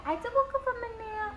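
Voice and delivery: high, soft voicing of the baby. Mother voicing her baby's words, high soft baby talk.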